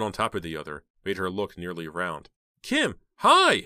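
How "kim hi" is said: high, loud delighted greeting; happy cry. A narrator's reading. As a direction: cry out, thrilled to see a friend. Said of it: "Heather cried, happy to see her".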